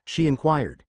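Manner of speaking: usual speaking voice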